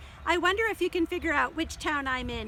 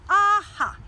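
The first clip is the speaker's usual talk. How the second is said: loud, high triumphant Aha; eureka aha; 7 semitones higher, 6 dB louder than usual. Shown as a demonstration. Librarian showing the code word, loud triumphant. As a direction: shout it like you solved it. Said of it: "that's what I would say".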